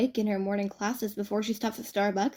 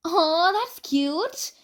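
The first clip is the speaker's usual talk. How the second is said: high, very slow aww; gushing; 8 semitones higher, 4 dB louder, much slower than usual. A narrator's reading. Mizuki cooing over An's walk with Akito, gushing. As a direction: coo it fondly. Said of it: "Mizuki gushes".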